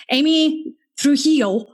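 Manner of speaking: "Trujillo" said slowly and tentatively, stress misplaced; a careful, unsure stab at saying "Trujillo"; mispronounced surname. Real, not acted. Host introduces her guest Amy by surname, mispronounced and tentative. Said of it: "Am I pronouncing that terribly?".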